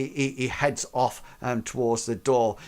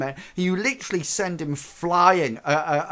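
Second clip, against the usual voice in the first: ordinary talk, nothing marked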